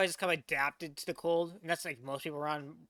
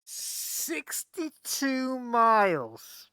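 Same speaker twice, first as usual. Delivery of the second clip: very slow, loud, higher-pitched disbelief; astonished; 4 semitones higher, 5 dB louder, much slower than usual. Real, not acted. Reactor repeating the skier's distance, drawn-out astonishment. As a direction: stretch every word out in amazement.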